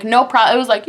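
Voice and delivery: ordinary talk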